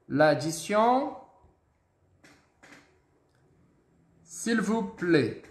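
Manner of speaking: "L'addition" and "S'il vous plaît" drawn out slowly; slow model phrase. Shown as a demonstration. Teacher slowly models ordering the bill. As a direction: spell out each syllable slowly for the class. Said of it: "a gente vai falar assim, ó".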